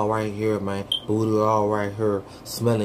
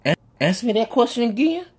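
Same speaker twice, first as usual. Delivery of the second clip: high startled stammer; 10 semitones higher than usual. Acted. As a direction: caught off guard, voice jumps up. Startled dad.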